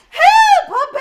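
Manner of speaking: high, loud panicked camper scream; hammed-up cry of help; mock scream. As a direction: shriek it like scared campers. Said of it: "makes the campers scream".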